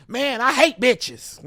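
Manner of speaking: loud, high, slow hollered line; hollering out. Retold or imitated. Another comic hollering on stage, loud slow. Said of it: "somebody hollering out".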